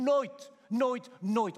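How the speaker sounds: each "Nooit" punched high and loud; emphatic insistence. Real, not acted. Preacher insisting Jesus was never forsaken, each "nooit" hammered.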